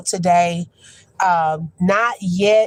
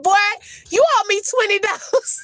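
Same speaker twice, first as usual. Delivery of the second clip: laughing mock demand; 11 semitones higher than usual. Confronting the boy, laughing.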